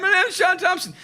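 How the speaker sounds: high, over-innocent lie to the cop; lightly put-on innocent voice; fake innocent. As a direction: sell the fake name sweetly. Insisting on fake name.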